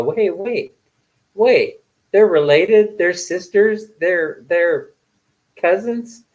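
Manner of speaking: high, rising mock disbelief on each question; surprised. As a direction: replay your own surprise at the news, pitched high. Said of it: "Yeah, I was surprised".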